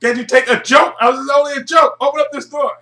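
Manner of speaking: imitating a panicked locked-in scientist; frantic shout. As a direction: bang on the door and yell to be let out. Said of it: "He said".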